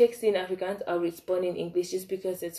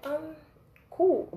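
much higher, drawn-out unimpressed reply; sarcastic um cool; 9 semitones higher than usual. Retold or imitated. Alyssa shrugging off the crying girl, sarcastic and unimpressed. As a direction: brush her off with fake politeness.

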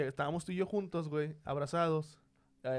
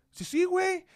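eager yes; 11 semitones higher, 7 dB louder, slower than usual. Retold or imitated. Misa eagerly agreeing in retold story.

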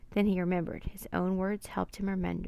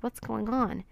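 usual speaking voice

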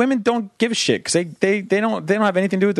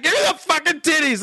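put-on demanding baby voice; 10 semitones higher than usual.

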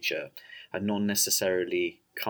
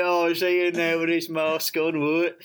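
higher, a bit louder cockney guard; hammed-up cockney question; cockney accent; 6 semitones higher, 4 dB louder than usual. Guard asking about the masked prisoner, cockney accent. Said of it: "he's slightly cockney".

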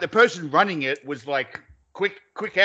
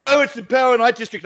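higher, louder mock voice of the crowd; imitated correction; 7 semitones higher, 5 dB louder, faster than usual. Friends correcting his deliberate wrong name, mock insistent. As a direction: mimic friends insistently putting you right.

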